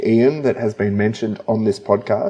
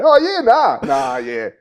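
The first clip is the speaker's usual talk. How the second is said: higher, drawled Aussie yeah nah; mock Australian accent; 8 semitones higher than usual. Repeating his co-host's line in a mock Australian accent. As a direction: echo it like a laid-back Aussie. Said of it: "The most Australian thing you could possibly say".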